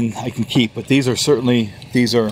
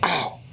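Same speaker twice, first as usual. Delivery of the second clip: sharp cry of pain; pained yelp; 5 semitones higher, 7 dB quieter than usual. Real, not acted. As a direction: yelp as the back spasms. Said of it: "having a little bit of back spasm there".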